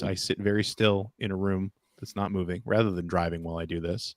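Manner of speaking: lightly voiced fatherly tone; a hint of a calm dad. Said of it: "using his dad voice".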